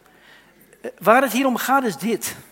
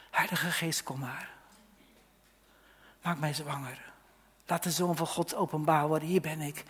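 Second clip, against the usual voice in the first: hushed prayer; 5 semitones lower, 9 dB quieter than usual. Acted. Preacher prays to the Holy Spirit.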